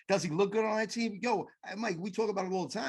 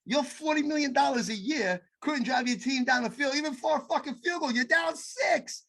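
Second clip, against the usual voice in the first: heated rant; 9 semitones higher than usual. Real, not acted. Angry rant at quarterback.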